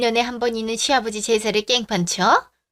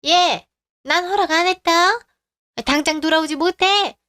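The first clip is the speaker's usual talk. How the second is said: a furious mother calling her son back; angry order; 6 semitones higher than usual. Acted. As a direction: stop him at the door with a raised, high voice.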